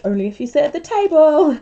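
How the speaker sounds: loud, high retold telling-off; mock scolding. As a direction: imitate someone laying down the rule loudly. Others scolding her, retold.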